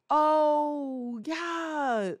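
high, drawn-out fake-pleased reply; stretched, strained mock approval; mock forced enthusiasm. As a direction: imitate a man feigning delight. Retells his dismayed attempt at enthusiasm.

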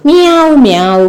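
lightly voiced mewing kitten, high start then falling; kitten's cry. Teacher voices the crying kitten for children, lightly imitated mewing. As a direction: mew like a little crying kitten. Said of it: "കുഞ്ഞി പൂച്ചയുടെ കരച്ചിലല്ലേ കേൾക്കുന്നത്".

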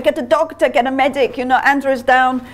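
mock panicked yell. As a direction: soldier shouting for help, retold and only lightly played. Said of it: "screaming for help".

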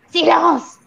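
high, sharp cry; shouted command. A narrator's reading. Le Gourdin to the laughing assistance, shouted command. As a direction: shout down a noisy room. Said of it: "cria mademoiselle Le Gourdin".

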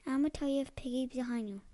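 usual speaking voice

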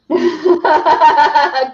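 loud, high real laugh; burst of laughter. Real, not acted. Amused by co-host's joke, laughing loudly.

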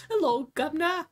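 chatty posh-dad British accent, hammed up. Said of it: "I shouldn't be allowed to do voices".

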